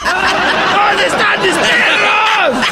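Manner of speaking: high, slow, drawn-out yell broken by laughter; laughing mock wail. Host laughing as the neighborhood taquero who lost his order, wailing. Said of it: "un taquero de mi barrio que no le llegó el pedido y decía".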